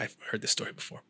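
soft, unvoiced mock listener grumble; mock bored listener. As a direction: breathe it out like a bored audience member. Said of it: "I'm gonna bore some people".

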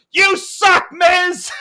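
high, loud mock heckle; imitating kid heckler. Kid heckling the Miz from ringside, high loud yell. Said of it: "I just hear".

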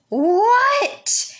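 loud, high, irritated "What?"; angry yell. Retold or imitated. Older sibling got no reply, yelling back angrily. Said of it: "in a really, really angry, loud voice".

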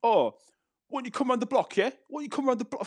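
high mocking chav taunt; mocking the chavs who want to fight, high and taunting; mocking chav challenge. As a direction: squeak out the tough-guy challenge. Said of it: "when they say".

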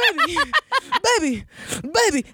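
high, mock-desperate repeated "baby"; hammed-up begging, stammering the one word; pleading boyfriend. Boyfriend begging both women at once, mock pleading.

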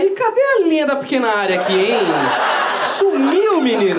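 baffled defender yelling at the end; confused defender, rising to a shout. Retold or imitated. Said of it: "os zagueiros iam ficar". (zagueiro perdido, subindo até um grito)

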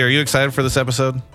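usual speaking voice

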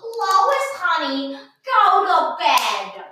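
put-on high, slow old-fashioned husband voice; drawn-out sleepy bedtime call; Lois impression voice. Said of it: "the impersonation of".

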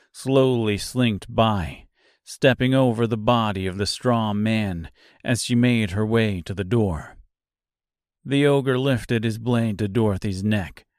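ordinary delivery, nothing marked

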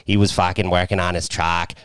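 imitated Boston accent, dropped R's; Boston wife's accent. Host imitating pitcher's wife in documentary, broad Boston accent. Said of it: "she had that hot ... she was like".

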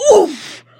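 loud winded oof; grunt oof. Acted. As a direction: grunt as if hit in the stomach. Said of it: "Grandpa Miller yelled the word".

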